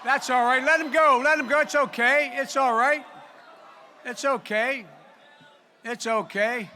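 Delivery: calm the crowd down from the podium; loud reassuring call that fades back to normal. Real, not acted. Calming crowd about a heckler.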